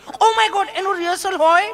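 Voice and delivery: high, shocked mock lady; mimicking city lady. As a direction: gasp it out high like a fussy tourist. Mimics tourist woman.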